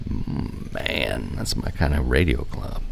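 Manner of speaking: low, hushed, savoring murmur; mock-sultry savoring. Host swooning over a radio club's sexy name, mock-sultry. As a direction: drop to a soft, appreciative near-whisper.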